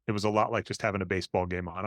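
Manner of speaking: usual speaking voice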